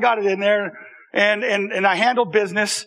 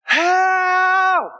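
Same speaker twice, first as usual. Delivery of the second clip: crying for help; 9 semitones higher than usual. Retold or imitated. Trapped boy shouting as uncle arrives. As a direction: call out for help in distress. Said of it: "he started hearing".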